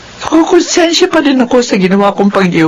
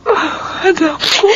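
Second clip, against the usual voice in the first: tender crying; 6 semitones higher, 4 dB quieter, slower than usual. Acted. Mother embracing her daughter.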